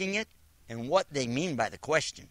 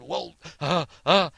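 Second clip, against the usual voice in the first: sputtering; 7 dB louder, slower than usual. Retold or imitated. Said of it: "sputtering and sparting like that".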